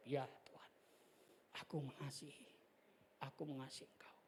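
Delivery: a whispered, halting answer. Retold or imitated. Peter answering Jesus the third time, whispered.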